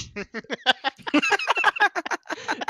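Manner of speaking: squealing burst of laughter; high laugh. Real, not acted. Teller laughing at the expired cake mix, squealing laugh. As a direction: crack up.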